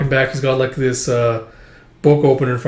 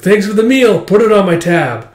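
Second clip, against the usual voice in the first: higher, louder, carefree character voice; brash freeloader; 7 semitones higher, 5 dB louder than usual. Acted. Freeloader thanks them for the soba, loud and brash.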